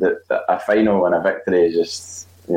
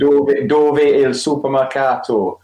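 put-on Italian phrase spoken in a Geordie accent, a little higher; 5 semitones higher, slower than usual. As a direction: mimic a Geordie teacher saying Italian. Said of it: "that's my best Geordie".

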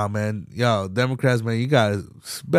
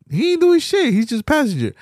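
much higher, louder, incredulous yell; outraged; 13 semitones higher, 4 dB louder than usual. Real, not acted. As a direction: protest in disbelief, voice shooting up. Outraged rant.

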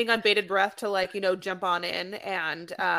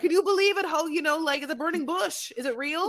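higher and louder, bursting with news; excited announcement; 7 semitones higher, 4 dB louder than usual. Retold or imitated. Son telling his family about his new woman, excited and loud. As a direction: shout it out like the best news ever.

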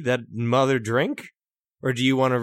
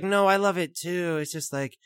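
much softer, trailing into a sigh; sad resignation; 2 semitones lower, 8 dB quieter than usual. Retold or imitated. A weary commuter sighing at a cheerful one. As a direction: sigh it out, defeated. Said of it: "And everybody's like".

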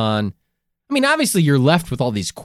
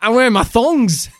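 higher put-on Aussie voice; mock Australian accent; 8 semitones higher than usual. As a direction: play a cheery caricature Aussie. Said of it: "this authentic Australian accent".

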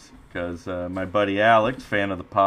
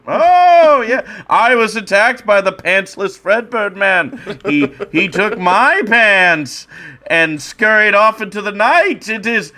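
high squeaky liar voice; 11 semitones higher, 6 dB louder than usual. Acted. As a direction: squeak out a nervous alibi. High put-on suspect voice.

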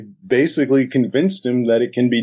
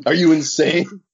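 mock incredulous; 5 semitones higher than usual. Repeats disbelief, mock voice.

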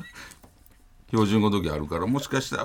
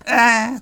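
high whispered eh; 6 dB louder than usual. Retold or imitated. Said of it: "とか".

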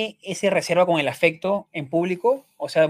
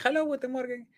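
put-on girl laughing back; 8 semitones higher, 7 dB quieter than usual. The cashier in Stuttgart echoing his greeting back, lightly imitated and teasing. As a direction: echo "Hello, guten Morgen" higher and softer, in a light teasing girl's voice. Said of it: "la chica se ríe y dice".

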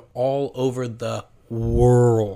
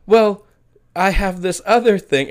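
teasing reveal; 9 semitones higher, 8 dB louder than usual. Retold or imitated. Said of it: "And I'm like".

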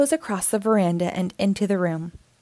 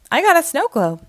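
put-on child voice; 8 semitones higher, 4 dB louder than usual. Kids excited about presents.

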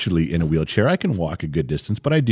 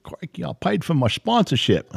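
higher-pitched, hammed-up Australian; mock Australian accent; 6 semitones higher, 4 dB quieter than usual. Host redoing the trucker's complaint, mock Australian accent. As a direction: have a rough go at Australian. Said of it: "How do you do an Australian?".

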